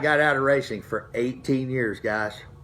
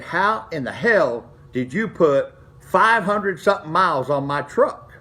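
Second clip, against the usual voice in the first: slow, louder imitated fatherly rant; mock-furious dad, drawn out and raised; retold angry dad; 4 semitones higher, 3 dB louder than usual. Dad who found the odometer miles, in a slow, louder mock outburst. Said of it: "he loses his shit".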